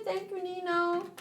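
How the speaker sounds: high squeaky little voice; squeaky Nino voice. Pretend. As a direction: pipe it up tiny and high.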